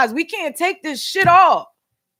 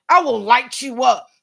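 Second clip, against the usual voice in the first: quoting the cop's threat. Retold or imitated. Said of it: "he was gonna light Sandra Bland up".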